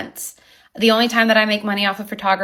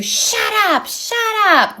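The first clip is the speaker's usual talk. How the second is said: high mock yell of shut up; shrill-free high pitched yelled shut up; retold scream; 12 semitones higher, slower than usual. Neighbor's claim, retold as a high yell at his wife. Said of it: "I screamed at his wife to".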